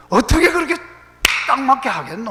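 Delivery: high, loud Gyeongsang exclamation of amazement; Gyeongsang dialect. Shown as a demonstration. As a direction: marvel loudly in Gyeongsang dialect. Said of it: "이거를 경상도 말로 하면요".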